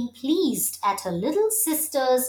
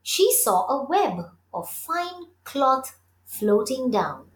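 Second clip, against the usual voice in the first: plain reading voice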